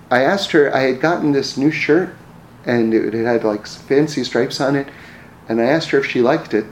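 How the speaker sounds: ordinary talk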